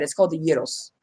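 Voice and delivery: "gyros" as YEE-rohs, silent G; correct pronunciation. Shown as a demonstration. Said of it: "that's not how it's said".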